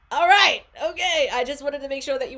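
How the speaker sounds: loud, high mock surrender; mock exasperated yell. Reader answering the insistent cards, mock exasperated shout. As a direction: shout back as if giving in.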